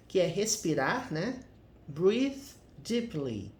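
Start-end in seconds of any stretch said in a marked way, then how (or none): 1.9-3.5 slow model reading. Shown as a demonstration. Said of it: "Respire fundo".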